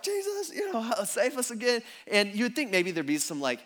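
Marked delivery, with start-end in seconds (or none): 0.0-1.8 high-pitched plea for rescue; mock freak-out. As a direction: squeak for help in pretend fear. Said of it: "they're probably freaked out".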